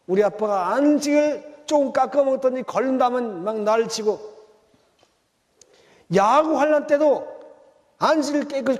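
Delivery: 높고 크게 외치는 설교; 회중을 향해 힘껏 토해내듯; impassioned preaching. Real, not acted. (설교의 절정에서 회중에게 높고 크게 외치는 목소리)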